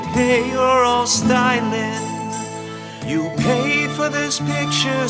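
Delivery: ordinary delivery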